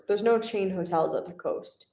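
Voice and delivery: normal talking voice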